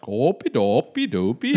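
sing-song Norwegian gibberish; mock Norwegian babble; Norwegian accent. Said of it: "they're all like Norwegians".

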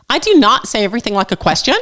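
indignant Southern uptalk; Southern girl uptalk. Acted. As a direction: deny it with a Southern rising lilt. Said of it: "sounds like a question".

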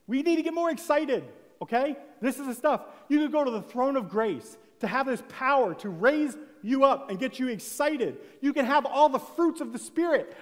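loud, rousing preaching at full force; fiery preaching. Acted. Preacher urging the church to get excited, fiery preaching. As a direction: preach it hard to lift the room.

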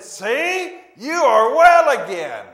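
loud, beaming welcome, words stretched slowly; enthusiastic greeting. Acted. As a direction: greet him like a long-lost friend, full of joy. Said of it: "He said it with enthusiasm".

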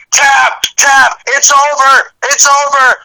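high, slow chant of tap; excited cheering. Retold or imitated. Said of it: "I say".

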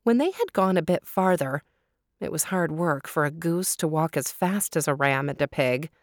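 ordinary delivery, nothing marked

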